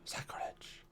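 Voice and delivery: gasp, then whisper in pretend shock; hushed, hammed-up scandalized reaction; mock-horrified whisper. Mock horror at computer in house.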